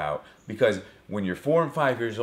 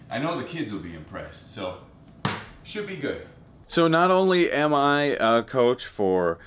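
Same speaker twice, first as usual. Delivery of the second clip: ordinary delivery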